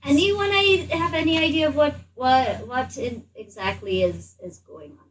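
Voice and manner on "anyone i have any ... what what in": ordinary delivery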